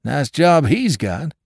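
high, slow wry remark; a dry, rueful comment. Acted. Drummer mopping his brow, high and wry. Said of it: "mopping his brow".

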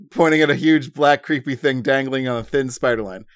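ordinary talk

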